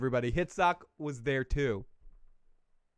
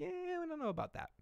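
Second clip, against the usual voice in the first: doubtful courtiers; 10 dB quieter, faster than usual. Retold or imitated. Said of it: "And they're like".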